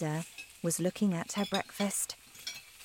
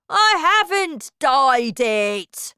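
loud, higher slow protest; angry denial; 8 semitones higher, 8 dB louder, slower than usual. Acted. Husband denying dyeing his hair, angry. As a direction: deny it hotly.

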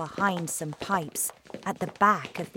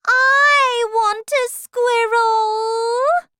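very slow, high, loud wail; drawn-out shriek of a demanding child; spoilt tantrum shout; 12 dB louder, much slower than usual. Acted. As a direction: stretch it into a bratty demand. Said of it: "shouted Veruca".

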